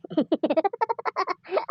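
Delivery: high, scheming snicker; sneaky mock-villain chuckle; sly laugh. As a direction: laugh like a traitor pleased with his plan. Traitor gloats over his scheme.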